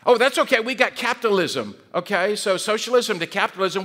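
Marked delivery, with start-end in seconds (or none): 0.0-1.8 higher, louder mock confidence; breezy reassurance. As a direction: wave the problem away, pitched up. Voices the capitalist answer.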